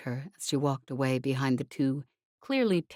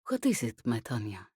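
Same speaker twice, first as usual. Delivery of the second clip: tender question; 5 semitones lower than usual. Acted. Wife tenderly greets Tanya.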